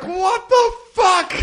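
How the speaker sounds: a huge high-pitched what, half laughing; squeaky stunned disbelief. Retold or imitated. Retelling being told the song had no words, a stunned, very high, laughing squeal. Retold baffled reaction.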